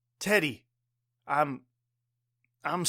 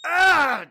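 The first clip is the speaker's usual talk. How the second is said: loud, high cry of pain; a loud, high scream of pain; 10 semitones higher, 6 dB louder than usual. Acted. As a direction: scream as the light hits. Blinded by a light.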